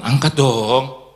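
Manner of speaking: slow, loud call to pick up; loud drawn-out urging. Acted. Preacher loudly urging the congregation to answer, drawn out. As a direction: stretch it out loud to rally the crowd.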